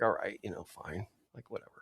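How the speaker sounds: low, soft imitation of grudging consent; reluctant giving in. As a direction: drop the voice, mock-resigned and dismissive. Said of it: "he didn't want to".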